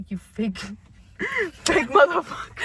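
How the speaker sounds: mocking her mom's refusal with a laughing yell; laughing mock shout.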